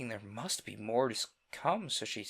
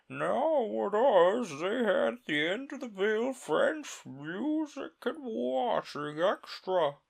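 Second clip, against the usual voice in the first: high, slow, boastful turtle; Mock Turtle voice; 10 semitones higher, 5 dB louder, slower than usual. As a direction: list it proudly and slowly.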